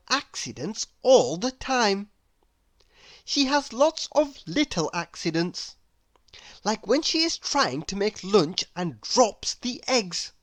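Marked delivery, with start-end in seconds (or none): none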